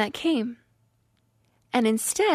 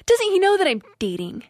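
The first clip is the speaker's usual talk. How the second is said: higher and louder, rising indignation; indignant retold thoughts, higher and louder; angry inner rant; 7 semitones higher, 4 dB louder than usual. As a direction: rant at him in your head, fuming. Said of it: "And I'm like".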